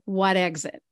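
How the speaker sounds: put-on casual New Jersey accent, quoting locals. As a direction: toss off the question like a Jersey local. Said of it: "a New Jersey accent".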